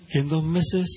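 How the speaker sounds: usual speaking voice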